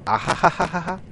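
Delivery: high, loud laughing fit. Acted. Toru cracking up along with his friends, laughing. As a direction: crack up with the others. トールも.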